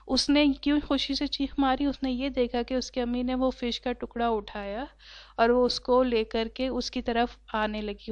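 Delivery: ordinary delivery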